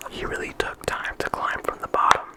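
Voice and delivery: ordinary talk, nothing marked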